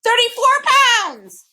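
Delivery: high, loud, slow shriek. A narrator's reading. Bird shrieking as he flies into fog, loud. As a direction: screech it as he vanishes. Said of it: "shrieked the bird".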